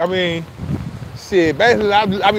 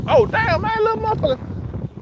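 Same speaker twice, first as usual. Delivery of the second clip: startled; 14 semitones higher, 4 dB quieter than usual. Real, not acted. Bird sneaking up on him.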